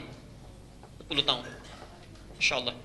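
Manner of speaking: whispered in awe; awed whisper. Real, not acted. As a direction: breathe the words out, almost silent. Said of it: "awed hushed aside".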